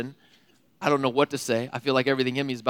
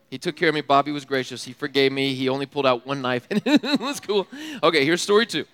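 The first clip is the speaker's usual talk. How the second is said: usual speaking voice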